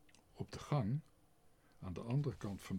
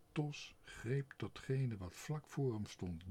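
ordinary delivery

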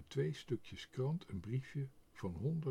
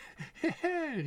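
very high chirping giggle; sparrow's giggle; 3 dB louder than usual. A narrator's reading. The sparrow giggles at his own theft, high chirpy giggle. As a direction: giggle like a pleased little bird. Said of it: "riep de mus".

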